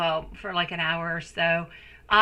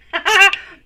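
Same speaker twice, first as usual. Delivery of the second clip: burst of laughter; 12 semitones higher, 12 dB louder than usual. Real, not acted. Laughs after teary remark.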